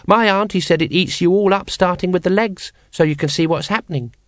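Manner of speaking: put-on high child's voice, chattering on; a small child relishing a grisly tale; chatty child. Child goes on about the dragon.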